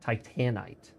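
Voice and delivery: TITANITE said tie-TAN-ite; preferred pronunciation. Shown as a demonstration. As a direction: stress the middle syllable of Titanite, full short A. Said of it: "I think it's".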